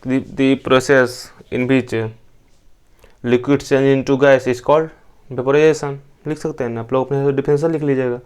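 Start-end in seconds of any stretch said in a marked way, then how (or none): 0.0-6.0 slow, careful dictation of a definition; teacher dictating word by word. Shown as a demonstration. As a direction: read it out slowly so they can copy it. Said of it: "definition से हम ऐसा लिख सकते हैं".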